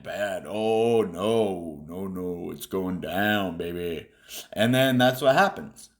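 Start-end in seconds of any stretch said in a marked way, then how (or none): 0.0-4.1 softer, low, slow mock despair; mock doom. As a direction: sink into a hammed-up gloomy sigh. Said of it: "all the other people are like".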